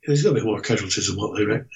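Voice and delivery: ordinary talk, nothing marked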